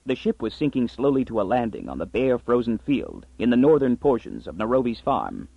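plain reading voice

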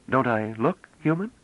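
high, careful foreign speaker; slightly hesitant. A narrator's reading. Young stranger answers in unfamiliar language, slightly hesitant. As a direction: strain a bit to pronounce it right. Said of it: "with just a trifle of hesitation".

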